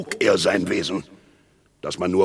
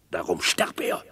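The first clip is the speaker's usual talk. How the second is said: geflüsterter Mordbeschluss; 6 semitones lower, 4 dB quieter than usual. Acted. Mordplan heimlich beschlossen.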